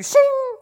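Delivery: very high, loud ringing shing; imitated sword-drawing sound. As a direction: mimic a sword whipped out of its sheath. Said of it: "We're pulling out the sword of the Spirit".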